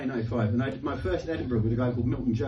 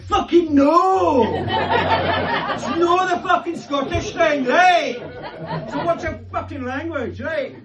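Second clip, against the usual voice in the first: high, slow, loud pretend outburst; hammed-up indignant shout; mock furious rant; 4 dB louder, much slower than usual. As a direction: bellow it back like an offended Scot. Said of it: "what I wish I'd done is ... said".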